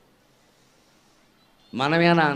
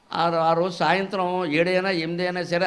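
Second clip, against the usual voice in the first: ordinary talk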